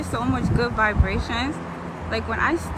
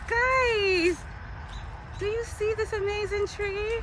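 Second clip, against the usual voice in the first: calling out; 9 semitones higher, slower than usual. Real, not acted. Calling viewers, louder.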